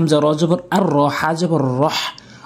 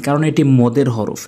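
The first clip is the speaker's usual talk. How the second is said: normal talking voice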